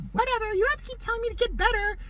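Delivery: high, fast mock-whiny voice; high put-on brat voice, rushed and dismissive; mocking whiny girl. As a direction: sneer it back like a sulky teen.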